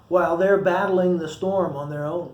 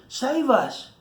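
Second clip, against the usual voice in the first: pleading; 8 semitones higher than usual. Acted. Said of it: "they pleaded".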